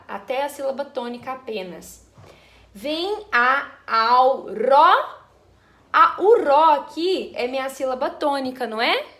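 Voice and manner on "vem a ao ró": very slow and loud, each syllable set apart, rising on "ró"; syllable count. Shown as a demonstration. Teacher counting poetic syllables up to the stressed one, very slow. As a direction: stretch out "Vem a ao ró" and lift the stressed "ró".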